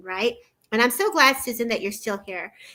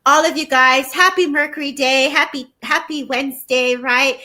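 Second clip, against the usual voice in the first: upbeat louder greeting; 2 semitones higher, 7 dB louder than usual. Real, not acted. Cheerful sign-off greeting to viewers.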